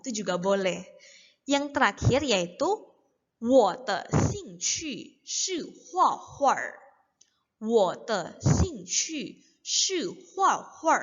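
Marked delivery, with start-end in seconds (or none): 3.4-11.0 very slow, word by word; slow model reading. Shown as a demonstration. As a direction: pronounce each syllable apart for learners. Teacher models sentence for learners. (contoh baca pelan, kata demi kata)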